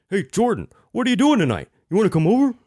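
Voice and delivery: high, loud booming call through cupped hands; a booming voice, muffled; muffled voice through wall. Shown as a demonstration. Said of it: "It would be like if I were just to, like, cup my hands".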